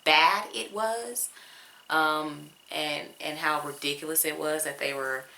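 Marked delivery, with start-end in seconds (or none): 0.0-1.3 bad drawn out slow, higher and louder; stress on bad. Real, not acted. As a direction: lean hard on "bad" and stretch it. Stressing how bad it was.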